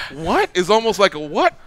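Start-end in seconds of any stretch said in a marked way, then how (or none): 0.1-0.5 loud, high rising what; mock disbelief. Shown as a demonstration. Host shows the losing fighter's expression, mock disbelief. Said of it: "That expression of going like". 1.2-1.5 loud, high rising what; mock disbelief. Shown as a demonstration. Host shows the losing fighter's expression, mock disbelief. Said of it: "That expression of going like".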